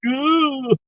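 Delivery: high, drawn-out letdown ooh; a let-down little ooh at the unsweet tea; mock dismay; disappointed ooh. Disappointed at wrong tea poured.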